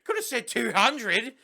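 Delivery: high, loud put-on teacher voice; hammed-up exasperation; exasperated teacher. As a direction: complain loudly as a mock schoolteacher. Teacher exasperated with Charlie, acted.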